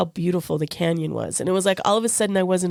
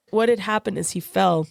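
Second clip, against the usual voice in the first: normal talking voice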